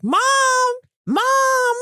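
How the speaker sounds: high, loud cat cry of Mom; cat calling Mom. Retold or imitated. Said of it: "it sounds like he's like".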